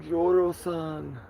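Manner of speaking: high, slow, drawn-out address; slow mock greeting. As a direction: croon the name. Addresses spider by name again.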